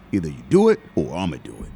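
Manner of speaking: a low, rapid-fire no-nonsense father; stern dad ultimatum. Retold or imitated. Father giving his son an ultimatum, low and fast.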